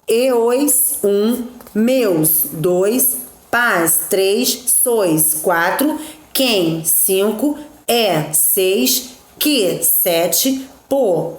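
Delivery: each poetic syllable sounded out on its own, then its count number spoken after it; slow scansion, tapping out one syllable at a time. Shown as a demonstration. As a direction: split the verse into metric syllables, counting each aloud. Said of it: "Todos observaram isso aí?".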